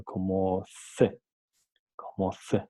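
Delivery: "C" said as a slow, isolated th sound; tongue between the teeth on "C", as in think; C interdental. Shown as a demonstration. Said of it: "el fonema S se pronuncia". ("C" pronunciada lenta, con sonido interdental)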